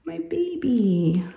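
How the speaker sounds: a mother's drawn-out wistful sigh; wistful lament. Real, not acted. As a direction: mourn that she's growing up. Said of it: "I know it's sad".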